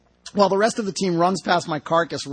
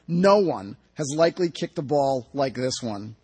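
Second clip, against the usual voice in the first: ordinary delivery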